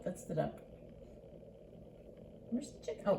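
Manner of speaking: ordinary delivery, nothing marked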